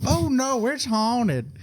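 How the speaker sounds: high Southern housekeeper voice. Acted. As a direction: voice her high and bright. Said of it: "I'm sticking with the voice though".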